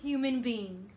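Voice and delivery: expressive picture-book read-aloud; reading to children. Acted. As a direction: read it warmly to a class. Teacher reads picture book aloud.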